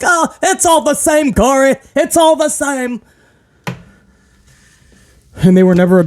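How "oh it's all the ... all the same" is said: high-pitched quoting voice; squeaky dismissive impression; high mock voice. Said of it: "Kevin Patrick was like".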